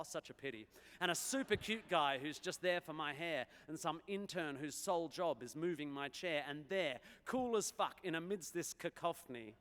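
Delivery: bouncy dactylic verse delivery; rhyming verse performance. Acted. Performs a rhymed verse poem.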